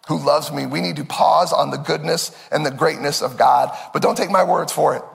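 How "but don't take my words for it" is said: ordinary talk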